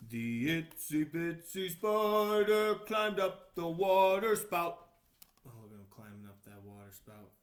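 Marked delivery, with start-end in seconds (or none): 0.1-4.9 put-on slow, loud read-aloud for children; storybook reading. A narrator's reading. Reading the book page aloud to children, slow and loud. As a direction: read it out big and slow for little listeners.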